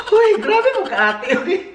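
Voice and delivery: high laughing outcry; laughing protest. Real, not acted. Brother laughing back at his sister's joke.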